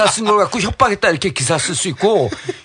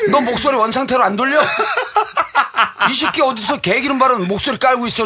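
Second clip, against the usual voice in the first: raised-pitch retold telling-off; angry scolding; 5 semitones higher than usual. Politician scolding the reporter for his put-on voice, angry. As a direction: demand it, re-enacting an indignant outburst.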